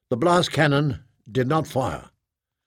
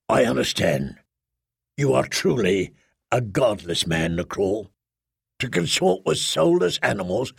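cold contemptuous commander. Acted. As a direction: look down on him coldly. Dahan condemns Nacrol.

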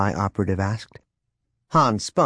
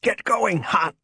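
high, strained urging; in pain; 11 semitones higher, slower than usual. A narrator's reading. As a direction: push the words out through a wave of pain. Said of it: "against a wave of pain".

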